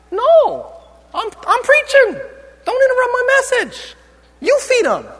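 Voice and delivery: high-pitched, comically put-out refusal; indignant Jesus. Acted. Preacher acting Jesus to his disciples, high indignant voice.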